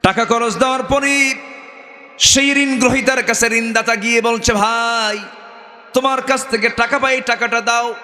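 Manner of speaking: high-pitched sermon storytelling; preaching. Acted. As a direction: tell the parable in a high preacher's voice.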